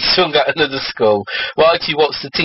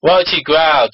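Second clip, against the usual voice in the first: usual speaking voice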